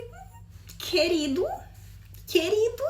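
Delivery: high, drawn-up "querido" with a near-tearful laugh; acted offended comeback, rising at the end; mock-hurt retort. Learner answering an impatient friend, mock-hurt.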